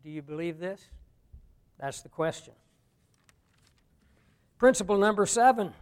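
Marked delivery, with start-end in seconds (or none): none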